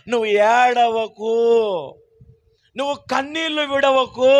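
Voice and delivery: preaching comfort; fervent consoling preaching. Acted. Preacher comforts those who weep, consoling preaching. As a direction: plead with the mourners.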